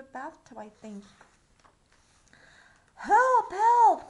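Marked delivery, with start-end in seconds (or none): 2.9-4.1 high loud cry for help. Acted. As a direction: shout it out like a panicked page. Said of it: "cried the court".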